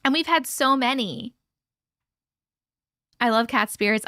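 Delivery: ordinary talk, nothing marked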